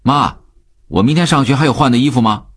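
put-on boy calling out a question. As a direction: call to your mother in the next room. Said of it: "楠楠大声地问".

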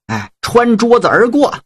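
ordinary delivery

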